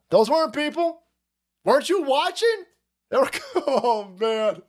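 grandfather pleading. Retold or imitated. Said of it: "he's like".

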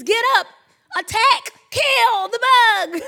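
high, loud mock-alarmed command; urgent battle cry. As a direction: shout it like rallying troops against a bug. Relaying what child's bug scream means.

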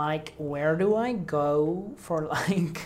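slow, drawn-out American parody; mock American speech, dragging the words; put-on American accent. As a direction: imitate an American tourist asking the way. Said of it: "'n ding gehad vir 'n Amerikaanse aksent".

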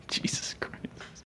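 slow, hushed whisper under the breath; barely audible exasperated whisper; whispered disbelief. Real, not acted. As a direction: whisper it slowly, aghast at the pick. Stunned by an absurd pick.

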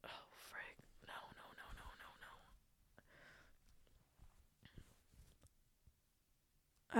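pleading with yourself not to cry; real tears, barely audible; crying whisper. Real, not acted. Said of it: "I'm gonna cry".

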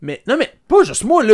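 lancé beaucoup plus haut, exaspéré; s'emporter pour rallier les autres; cri exaspéré. Real, not acted. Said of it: "crier comme toi à l'instant". (cri exaspéré, plus haut)

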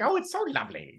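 high-pitched cooing puppet voice; talking hat voice. Acted. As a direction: coo it high, half laughing. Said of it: "it's that perfect voice".

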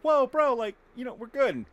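loud, high startled shout at a bear; surprised shout, then easing off. Pretend. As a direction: yell to calm the bear down. Calming a roaring bear.

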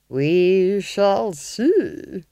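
very slow, drawn-out threat; menacing Duchess. A narrator's reading. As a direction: savour each word ominously. Duchess threatens with torture tools.

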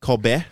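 "Kobe" as "KOB-eh", not "KOH-bee"; careful looked-up "KOB-eh" for Kobe; Japanese-style pronunciation. Shown as a demonstration. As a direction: say Kobe with a short o, ending in "beh". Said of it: "had to look up the pronunciation".

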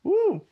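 short, very high "woo"; high whoop. Real, not acted. Storyteller capping a bad job story, high whoop. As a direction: let out a quick high whoop.

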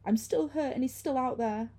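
high, plaintive pleading; begging. A narrator's reading. Hurt woman pleads from behind, begging. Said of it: "she begged".

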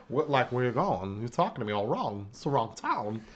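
a lightly voiced, confused Walken-style rambling; Christopher Walken impression. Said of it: "Christopher Walken".

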